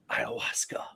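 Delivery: hushed, conspiratorial dealer; mock whisper. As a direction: whisper the word like a secret. Retold drug dealer's hushed pitch.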